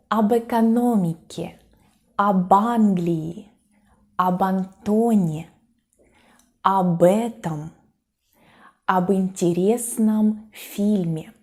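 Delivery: each "об" run into the next word as "ab-"; careful list, "об" fused to the following vowel; preposition joined on. Shown as a demonstration. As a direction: link "об" straight onto "экономике", "Англии", "Антоне" with no gap. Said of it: "we kind of join the preposition and the following".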